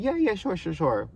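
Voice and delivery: retold placating "sure"; insincere agreement. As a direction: agree just to get away. Brushing off his invitation to meet.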